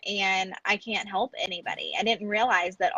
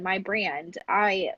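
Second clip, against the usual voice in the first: ordinary delivery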